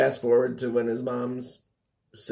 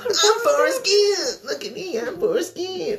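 high, slow, whiny mockery; mocking bully; 4 dB louder than usual. Friend quoting the bullies taunting Forrest, high whiny mock.

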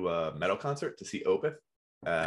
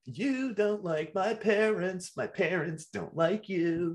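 high, slow, whiny emo croon; emo singer voice; 9 semitones higher, much slower than usual. Shown as a demonstration. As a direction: sing it like a sulky emo frontman. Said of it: "the key to an emo song".

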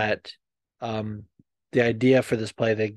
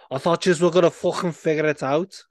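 louder, higher Liverpool Scouse; Scouse accent; 5 semitones higher, 5 dB louder than usual. Shown as a demonstration. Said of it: "like, Scouse".

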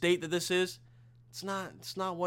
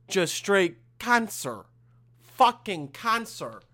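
slow, louder, drawn-out complaint; emphatic disgust; 4 semitones higher, 5 dB louder, much slower than usual. Real, not acted. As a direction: drag out each word with exasperated emphasis. Complaining about a brutal exam schedule.

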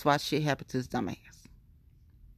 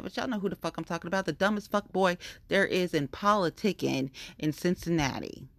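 ordinary talk, nothing marked

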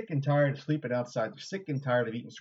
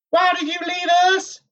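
much higher, wailing female character voice; mournful reproach; 10 dB louder than usual. A narrator's reading. Egwene's apparition blaming Rand, mournful high voice. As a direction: lament in a high, pained voice. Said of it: "she said sadly".

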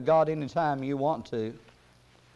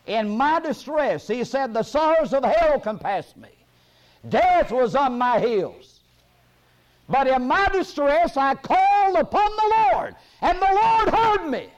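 high, loud, stretched-out proclamation; full-force preaching; 11 semitones higher, 7 dB louder than usual. Acted. Preacher proclaiming to congregation that the Lord heard, full-force preaching. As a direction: build to a ringing preacher's shout.

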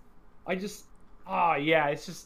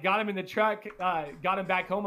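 normal talking voice

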